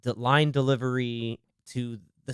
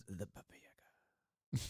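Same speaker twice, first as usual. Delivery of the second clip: hushed whisper of a scary name; spooky whisper; 5 semitones lower, 21 dB quieter, much faster than usual. Pretend. Friends recapping a movie, whispering the name. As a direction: breathe the name like a ghost story.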